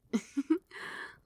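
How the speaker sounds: a fond little giggle. Acted. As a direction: giggle with delight at the request. Pet asks to be little spoon.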